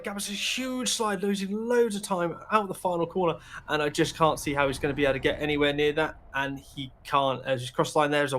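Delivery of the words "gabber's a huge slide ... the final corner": excited slide call. Real, not acted. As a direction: pitch jumps up, urgent play-by-play. Live call of a big slide.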